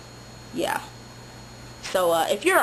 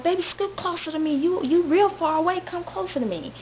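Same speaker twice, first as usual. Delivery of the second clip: put-on coaxing boyfriend voice; 7 semitones higher than usual. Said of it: "he's like".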